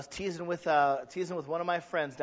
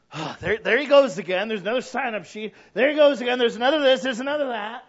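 louder, higher grumbling at sign-ups; mock complaint; 6 semitones higher, 10 dB louder than usual. Grumpy churchgoer griping about sign-up sheets, mock complaint. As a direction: whine about another sign-up.

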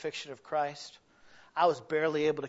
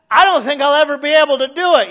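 high, loud hopeless protest; mock despairing churchgoer, pitched way up; discouraged listener; 12 semitones higher, 22 dB louder than usual. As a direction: wail that it's impossible.

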